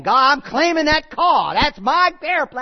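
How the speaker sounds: loud, high mock televangelist shout; arrogant preacher. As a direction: belt it out slowly like a grasping showman preacher. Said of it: "I don't mean that in an arrogant way".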